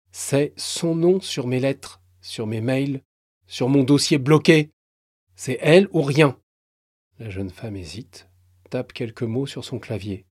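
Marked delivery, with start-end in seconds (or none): 0.1-6.4 de plus en plus fort, buté; insistance montante. Acted. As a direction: marteler qu'il ne veut qu'elle. Franck s'obstine. (Franck obstiné, la voix qui monte)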